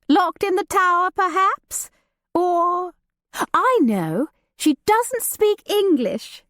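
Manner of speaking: light and teasing, a society lady; Judith, lively young lady. Acted. Judith teasing her cousin about the new servant, playful and high. Judith voiced beside narration.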